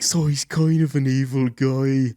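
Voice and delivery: slow, lightly put-on Irish deadpan; mimicking Barry explaining the Joker; mock Barry Keoghan. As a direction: understate it like Barry on a talk show. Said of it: "And he's like".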